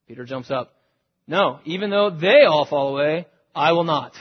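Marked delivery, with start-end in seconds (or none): 1.3-4.1 Peter's loud, insistent protest. Acted. Peter protesting to Jesus, loud and insistent. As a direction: insist loudly, jumping up to object.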